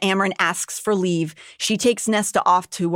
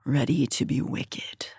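soft whispered purr; seductive demon purr; 5 semitones lower, 6 dB quieter than usual. Acted. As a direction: breathe it into her ear, low and slow. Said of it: "he purred in my ear".

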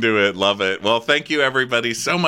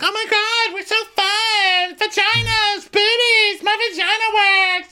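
squealing falsetto, far higher and louder; over-the-top party girls; 4 dB louder than usual. Retold or imitated. Brandy and Tamara forcing fun, shrieking falsetto. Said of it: "trying so hard to be like".

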